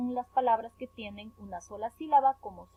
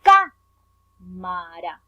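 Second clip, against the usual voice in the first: "cámara" with CÁ punched very loud, then "mara" drawn out; stress on CÁ; 4 semitones lower, 11 dB louder, much slower than usual. Shown as a demonstration. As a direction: hit "cá" hard, pause, finish "cámara" slowly. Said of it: "la sílaba con mayor fuerza". ("cámara" con mucha fuerza en "cá", muy lento)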